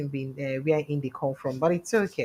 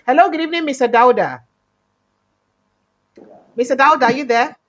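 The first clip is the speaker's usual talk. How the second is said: much higher, louder call to a guest; calling out to caller; 7 semitones higher, 10 dB louder, faster than usual. Real, not acted. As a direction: call out loudly to check he's on the line. Greeting a caller on the line.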